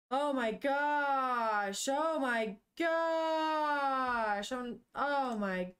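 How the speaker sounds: slow, stretched shocked oh my gosh; shocked gasp. Real, not acted. Reacting to the scary turn.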